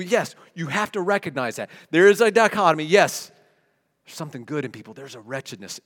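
normal talking voice

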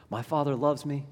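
normal talking voice

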